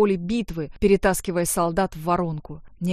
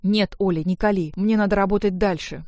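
usual speaking voice

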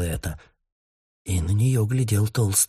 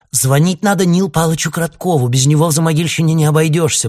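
loud, quick blurt, raised high; 8 semitones higher, 7 dB louder, faster than usual. A narrator's reading. Ksyusha tells her sister whom to call, blurted loudly. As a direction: blurt it out before thinking. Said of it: "брякнула Ксюша".

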